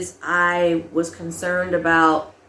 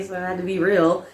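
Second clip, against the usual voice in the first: normal talking voice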